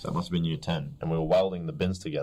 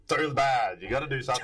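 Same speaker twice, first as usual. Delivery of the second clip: mock teacher; 8 semitones higher than usual. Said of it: "He's like".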